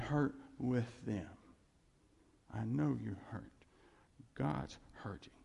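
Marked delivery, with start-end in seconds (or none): 2.5-5.3 soft, gentle consolation; low, tender reassurance; comforting. Shown as a demonstration. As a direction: console someone who is grieving, quietly. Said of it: "See, that's comforting".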